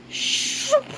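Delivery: airy, whispered "shoop" whoosh; whooshing sound effect. A narrator's reading. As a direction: make a quick voiceless whoosh. Imitated door or motion effect.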